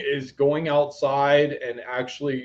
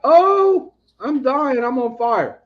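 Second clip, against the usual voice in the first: high, loud, hammed-up cry of the figure on the card; burning tarot figure; 10 semitones higher, 7 dB louder, slower than usual. Reader voicing the Tower card's burning man, high hammed-up wail. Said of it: "he's like".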